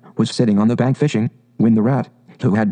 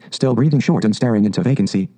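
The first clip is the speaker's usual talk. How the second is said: ordinary delivery, nothing marked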